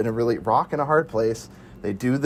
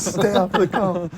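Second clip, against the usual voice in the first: slow, higher echo of the line; slow mock echo; 6 semitones higher, slower than usual. Friend echoing a quoted movie line, slow. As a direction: repeat it slowly, savoring.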